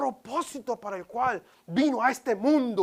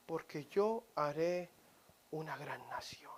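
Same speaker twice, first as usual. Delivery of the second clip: soft promise; 6 semitones lower, 6 dB quieter than usual. Acted. Angel's tender promise.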